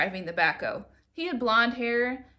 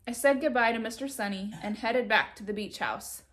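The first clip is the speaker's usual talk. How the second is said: ordinary delivery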